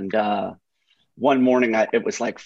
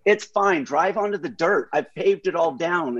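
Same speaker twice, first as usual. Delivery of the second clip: higher, breezy reassurance; mock carefree Hoff; 4 semitones higher than usual. Mimicking Hoff urging the Camry onto the dirt, breezy and higher. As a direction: wave off the danger in a higher voice.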